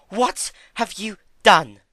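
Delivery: high, loud, slow panic; loud high alarmed question; panicked. A narrator's reading. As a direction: cry out in panic. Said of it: "panic edging her voice".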